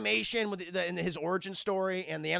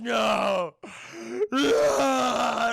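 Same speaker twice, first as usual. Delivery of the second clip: loud, anguished scream building higher; 3 semitones higher, 6 dB louder than usual. Retold or imitated. Disfigured Clayface seen by his double, anguished screaming. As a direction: wail it out louder and higher. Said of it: "And just screaming".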